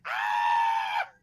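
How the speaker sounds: loud drawn-out yell; mock terrified scream. Acting out a child scared by zombie brothers, loud mock scream. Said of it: "And it's like".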